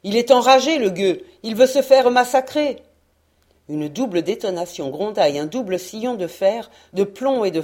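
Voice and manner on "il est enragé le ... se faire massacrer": higher, louder alarmed outburst; alarmed shout. Acted. Sailor shouting in alarm at the stubborn English. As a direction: shout it in disbelief.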